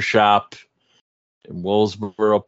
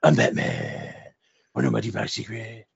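mock Batman, dropped way down; retelling mocking the movie, in Batman voice; 2 semitones lower, 5 dB quieter than usual. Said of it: "So I was like".

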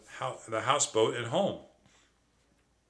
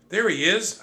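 loud high shout; 10 semitones higher, 7 dB louder than usual. Acted. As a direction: call out spotting him. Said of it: "I heard someone shout".

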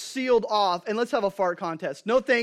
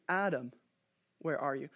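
soft seeking call; 5 semitones lower, 7 dB quieter than usual. Retold or imitated. God calling Adam again.

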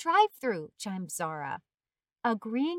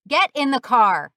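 loud barked police order; shout; 13 dB louder than usual. A narrator's reading. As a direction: shout it as a command. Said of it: "shouted the policeman".

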